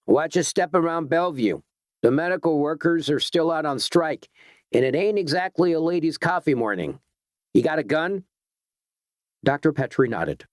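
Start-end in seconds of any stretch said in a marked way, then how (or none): 0.1-8.2 cop calling out. A narrator's reading. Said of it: "the cop called out".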